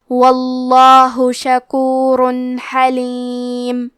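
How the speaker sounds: آہستہ، کھنچی ہوئی تجوید والی تلاوت; ہر حرف کو مخرج سے ادا کرتے ہوئے آہستہ تلاوت; قرآن کی تلاوت. Shown as a demonstration. تلاوت کا انداز. (شاگردوں کو سنانے کے لیے آہستہ تجوید سے تلاوت)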